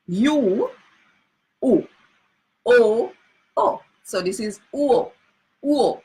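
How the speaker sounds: U and O sung out high, one by one; Jamaican vowel drill. Shown as a demonstration. Teacher drilling the spun-round vowel sounds. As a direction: call each vowel out clearly. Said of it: "Pay close attention".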